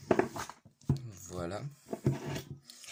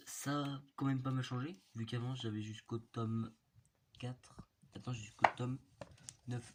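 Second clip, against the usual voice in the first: ordinary delivery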